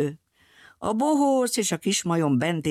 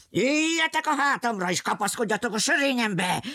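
higher urgent call to climb on; lion calling out; 7 semitones higher than usual. Acted. Lion tells the friends to grab his mane, higher urgent call. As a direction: urge them onto his back. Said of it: "kiáltotta".

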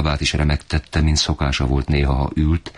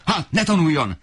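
much higher, furious retort; shouting father. A narrator's reading. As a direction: cut the mother off in rage. (magasabb, dühös visszavágás)

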